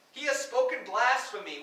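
loud indignant high priest; outraged accusation. Acted. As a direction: denounce him before the council. High priest tears clothes, accuses.

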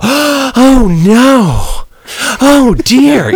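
shrill-free falsetto gasp, hammed-up airhead; mock dumb woman. Mocking the movie-theater woman with a hammed-up high gasp.

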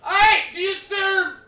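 slow, high, loud mock shout; drunk shout at the bar. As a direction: bellow across the bar.

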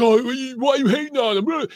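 high, loud whiny mimicry; exaggerated high-pitched mock protest; mocking online critics. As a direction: put on a silly high voice to mock complainers. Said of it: "people responded to me like".

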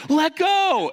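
high loud order; final command. Retold or imitated. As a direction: shout the last order.

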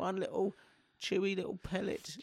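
soft pleading. Real, not acted. Wheedling for a sweet.

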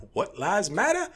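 high, slow, exaggerated confusion; parodied baffled question; mock puzzled bystander. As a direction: play the clueless onlooker, drawn out.

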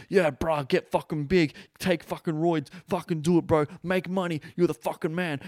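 mock bro hype; mocking alpha bro. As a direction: pump him up like a meathead bro. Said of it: "and you're like".

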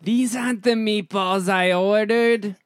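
slow, loud, high voice with New York vowels; high-pitched, New York. Pretend. Actor attempting an airier high take, slow high New York voice. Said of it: "More high pitched, more airy, right?".

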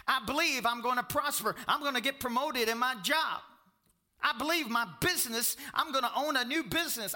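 loud, high-pitched pulpit delivery; full-force preaching. Real, not acted. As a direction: preach it out loud to the whole room.

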